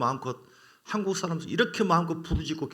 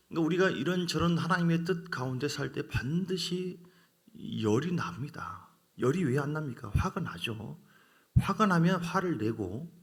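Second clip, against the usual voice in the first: usual speaking voice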